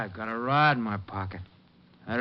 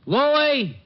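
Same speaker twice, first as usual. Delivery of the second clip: loud call to a partner in the next room; 11 semitones higher, 4 dB louder than usual. Acted. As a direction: yell the name across the apartment. Thug calling partner from next room.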